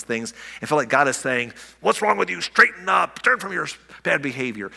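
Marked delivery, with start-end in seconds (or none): 1.8-4.7 higher, sharp reproach, imitated; stern scolding God. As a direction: scold like a harsh, disapproving God. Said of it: "But that's not the tone".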